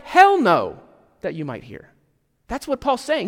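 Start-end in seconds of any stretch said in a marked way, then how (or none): 0.1-0.8 loud, high Hell no; a punchy, exasperated refusal; emphatic hell no. Retold or imitated. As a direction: blurt Hell no with full force. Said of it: "or, frankly, more like".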